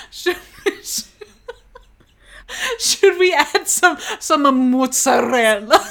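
mock Italian-American. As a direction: parody a person who thinks they're Italian, giggling through it. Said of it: "they think they're really Italian, they're gonna be like".

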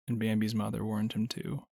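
ordinary delivery

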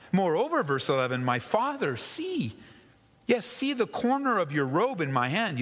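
high, urgent plea from David to the king; pleading David. Acted. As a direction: beg Saul to see the truth, voice straining upward.

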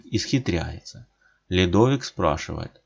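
ordinary delivery

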